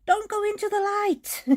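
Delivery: high spooky movie quote voice; high mock-dramatic warning; movie character quote. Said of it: "the one friend says".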